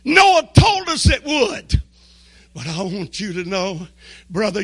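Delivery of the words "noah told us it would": high, loud panicked scream; high-pitched shout of alarm. Acted. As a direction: scream it out as a warning to everyone. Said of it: "somebody screamed out and said".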